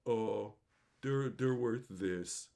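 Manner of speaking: slow, low, halting imitation; mock reluctant concession. Cornered trader finally naming the price, mock reluctant concession. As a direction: mimic backing down haltingly, pitched low and drawn out.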